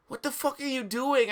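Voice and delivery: high angry outburst; a shrill mock-angry yell; angry shout. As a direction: shout at him, outraged. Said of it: "I shooted angrily".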